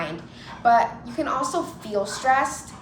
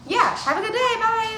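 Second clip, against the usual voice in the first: high sing-song farewell; polite goodbye; 11 semitones higher than usual. Retold or imitated. Dog owner parting from a stranger, high and polite. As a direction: imitate an over-polite, chirpy send-off.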